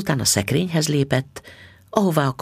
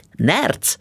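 exclaiming; 7 semitones higher than usual. A narrator's reading. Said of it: "kiáltotta mister Bixby".

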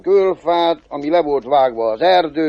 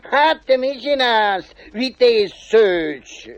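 high, drawn-out devil question; chuckle, then ask slowly in a high devil voice; chuckling devil; 7 semitones higher, slower than usual. Acted. Devil finding the cobbler digging round the well, high-voiced amused question. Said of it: "Kérdi az ördög".

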